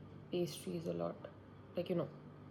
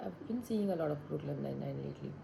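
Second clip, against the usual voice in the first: ordinary talk, nothing marked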